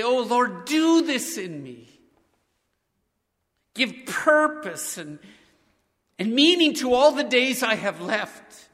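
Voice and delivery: humble prayer. Shown as a demonstration. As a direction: lead the church in prayer, drawing out each phrase. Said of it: "would you just pray with me, humbly".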